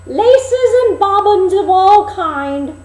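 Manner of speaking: put-on very high, loud, slow old peddler call; a disguised queen hawking like an old crone; peddler woman crying wares. Queen as old peddler calling at the dwarfs' door.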